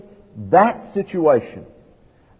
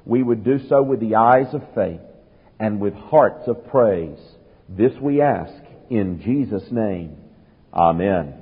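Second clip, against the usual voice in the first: prayer; 6 semitones lower than usual. Acted. Pastor closing the prayer before the sermon, hushed.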